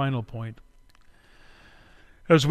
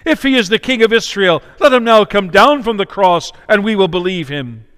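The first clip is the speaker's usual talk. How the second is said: mocking; 5 semitones higher, 9 dB louder than usual. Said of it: "mocking with the scribes".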